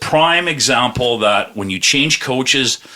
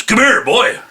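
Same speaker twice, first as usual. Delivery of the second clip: raised-pitch Texan command; big Texan; 11 semitones higher, 3 dB louder, slower than usual. Retold or imitated. Tall Texan calls him over in the bathroom, commanding impression. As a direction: imitate a big cowboy summoning someone. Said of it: "I see this big, tall Texan".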